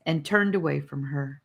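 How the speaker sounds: ordinary delivery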